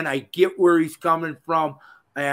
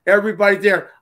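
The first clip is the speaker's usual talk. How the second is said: ordinary delivery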